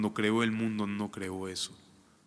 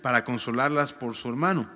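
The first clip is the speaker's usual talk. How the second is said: steady, raised Bible reading voice; formal reading of the Gospel; scripture reading; 4 semitones higher, 7 dB louder than usual. Acted. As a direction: read the passage out to the congregation. Reads scripture aloud.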